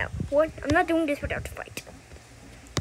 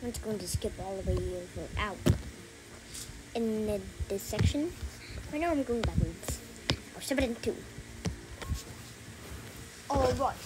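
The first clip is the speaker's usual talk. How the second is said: normal talking voice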